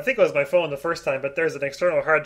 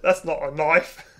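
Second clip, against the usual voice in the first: slow Aussie movie-line imitation; mock Australian accent; slower than usual. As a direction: stretch it out in put-on Australian. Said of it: "neither of us have ever seen Crocodile Dundee".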